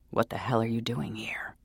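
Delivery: urgent hissed demand; angry whispered demand; half whisper. Acted. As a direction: demand it under your breath. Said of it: "a voice demanded in half whisper".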